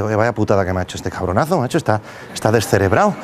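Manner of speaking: grumbling driver. Acted. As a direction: grumble to yourself, fed up. Driver grumbles after robbery.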